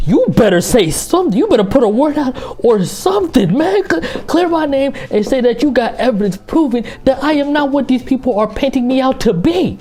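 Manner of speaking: high-pitched, heated pleading; worked up, voice pushed high; indignant demand. Acted. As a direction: demand that the lawyer speak up. Acted demand to his own attorney.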